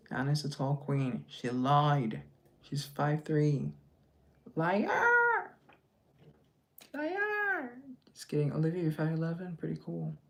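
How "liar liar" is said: shrill high playful yell of liar; high mock cry of liar; mock outrage. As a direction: tease her, squeal the accusation. Teasing viewer who lied height.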